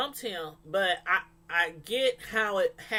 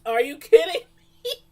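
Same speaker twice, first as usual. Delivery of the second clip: much louder, higher incredulous outburst; mock disbelief; 6 semitones higher, 9 dB louder than usual. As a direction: belt out your disbelief. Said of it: "I was like".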